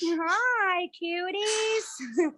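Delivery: high sweet voice to birds; pet talk. Real, not acted. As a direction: coo at the little birds. Greeting birds in cage.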